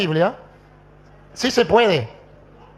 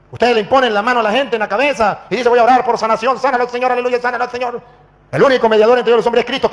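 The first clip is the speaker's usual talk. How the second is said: raised, loud mocking tirade; re-enacted indignant challenge; heated rebuke; 5 semitones higher, 4 dB louder than usual. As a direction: mimic a healing prayer to accuse them. Rebukes pastor, mimics his healing prayer.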